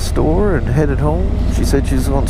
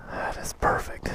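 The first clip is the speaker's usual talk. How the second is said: breathy awed whisper; hushed admiring whisper; 14 dB quieter than usual. Real, not acted. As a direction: whisper it to yourself in awe. Admiring the bike in the garage.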